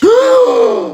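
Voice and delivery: shrill shocked gasp, loud; sharp high gasp. Acted. Fortune-teller to pupil, loud shrill gasp of shock. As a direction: gasp as the vision hits.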